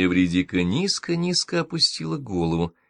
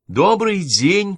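high, drawn-out sweet chirp, louder; bird-of-paradise singsong; 12 semitones higher, 7 dB louder, much slower than usual. A narrator's reading. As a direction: sing out the greeting like an exotic bird. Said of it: "пропела она голосом райской птицы".

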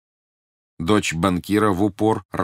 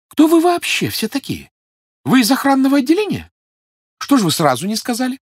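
very high, loud mock amazement; feigned surprise; 7 dB louder than usual. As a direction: gush with put-on astonishment. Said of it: "изобразив крайнее удивление".

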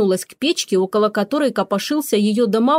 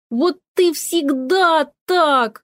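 high, drawn-out aggrieved wail; wailing cat; 6 semitones higher, much slower than usual. A narrator's reading. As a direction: wail slowly like a wronged cat. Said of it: "завопил Кузьма". (протяжный вопль обиженного кота)

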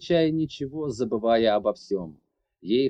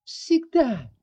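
higher, furious "Всегда" with a falling end; hissed; 12 semitones higher, 3 dB louder than usual. Acted. Larry hissing at the man, furious. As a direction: spit out "Всегда" in anger. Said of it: "прошипела".

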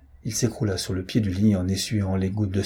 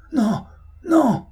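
high, louder anguished cry; screaming no; 5 dB louder than usual. A narrator's reading. Tortured prisoner screams no at his torturer, high and loud. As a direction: scream in pain. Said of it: "hurla Dobreck".

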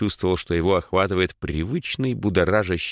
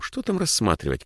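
normal talking voice

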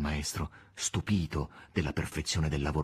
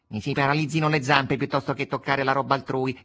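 higher, mildly pompous exclamation; haughty cat; 6 semitones higher, 4 dB louder than usual. A narrator's reading. Cat denying he would touch others' property, haughty. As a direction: protest with lofty self-importance. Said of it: "esclamò il gatto con sussiego".

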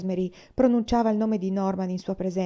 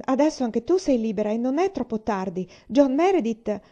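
higher, louder eager plea; urging eagerly; 5 semitones higher, 6 dB louder than usual. Acted. Ellen urging her sister toward John Meredith, eager and raised. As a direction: press her gently but keenly.

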